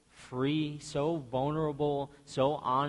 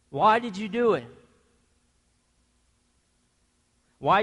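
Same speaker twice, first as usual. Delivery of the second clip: loud, higher, accusing; imagined angry Joseph; 5 semitones higher, 6 dB louder than usual. Pretend. Joseph imagined confronting the brothers who sold him, angry and loud. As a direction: hurl every question at them.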